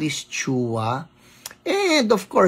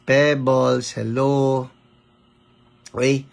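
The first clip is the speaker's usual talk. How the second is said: normal talking voice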